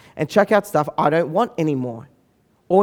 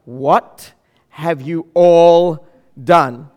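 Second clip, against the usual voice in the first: drawn out, louder, aghast; slow stunned disbelief; 2 semitones lower, 5 dB louder, much slower than usual. Retold or imitated. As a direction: brother finds his clothes bagged, slow shocked question. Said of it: "And he's like".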